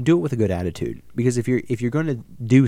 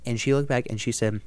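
usual speaking voice

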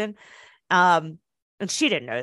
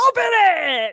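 excited command; 10 semitones higher, 5 dB louder, slower than usual. Pretend. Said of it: "And I'd be like".

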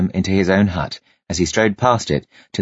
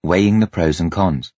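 ordinary delivery

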